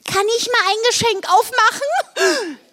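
very high, silly character voice; sudden eager question. Acted. Blodi interrupts the shocked silence, eager. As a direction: blurt it out in a squeaky, pleading pitch. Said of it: "fragte Blodi plötzlich".

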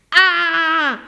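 loud, high drawn-out howl; bellowed scream. A narrator's reading. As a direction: scream as if badly hurt. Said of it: "Er brüllte wie am Spieß". (lauter, gespielter Schmerzensschrei)

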